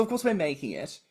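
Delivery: usual speaking voice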